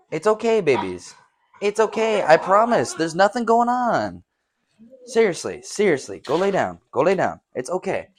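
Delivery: high, loud reassuring pet voice; soothing the dogs. Real, not acted. Reassuring his dogs nothing's outside, high soothing voice. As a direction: coax them to calm down.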